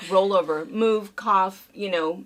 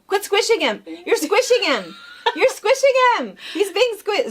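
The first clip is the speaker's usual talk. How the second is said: higher, louder mock scolding; scolding parent; 10 semitones higher, 5 dB louder than usual. Mother scolding her kids for squishing the cat, mock scolding. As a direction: tell the kids off over and over. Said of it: "it was always the same thing".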